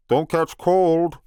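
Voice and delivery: a big man being kind; giant Turk. Acted. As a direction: Turk to Buttercup, slow and loud.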